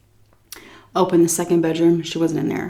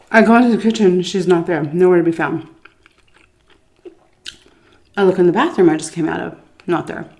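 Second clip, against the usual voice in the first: mouth full; 3 dB louder than usual. Real, not acted. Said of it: "talking with my mouth full".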